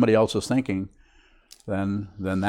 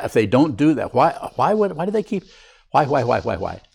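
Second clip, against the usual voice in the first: pitched-up fretful questioning; mock exasperation; 5 semitones higher, 4 dB louder than usual. Teacher mimicking people's inner complaints about others, mock exasperated. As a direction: pile up the whys in mock agitation.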